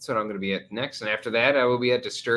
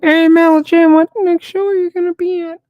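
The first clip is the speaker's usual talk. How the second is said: very loud, high voice up close to the mic; into the microphone; 16 dB louder than usual. Pretend. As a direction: blast the question straight into the microphone.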